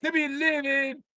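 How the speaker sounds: high, slow put-on announcer voice; hammed-up cruise captain; announcing the stops of the lake cruise; mock captain announcement.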